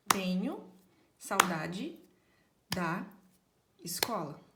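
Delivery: Tenho saudade da escola, each word set apart with a beat; clapping each word. Shown as a demonstration. Teacher claps each word for the class. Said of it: "Vamos bater palma pra cada palavra".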